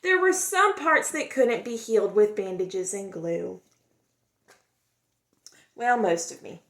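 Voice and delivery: expressive storybook read-aloud; gentle, lively storytime voice; reading to children. A narrator's reading. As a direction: tell it like a picture book for kids. Reads picture book aloud.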